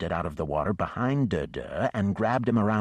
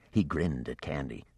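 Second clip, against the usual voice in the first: plain reading voice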